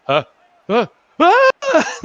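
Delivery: two puzzled huhs, then a sudden very high, loud shriek; startled scream. Retold or imitated. Lawyer shrieks at Burns's twelve lawyers, high panicked scream. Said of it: "Hutz is just like".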